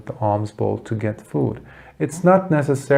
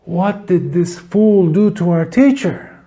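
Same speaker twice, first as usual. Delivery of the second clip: higher, indignant cry of the students; outraged students; 5 semitones higher than usual. Retold or imitated. Students outraged at the man who hit their teacher. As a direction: demand it with shocked outrage. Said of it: "They're like".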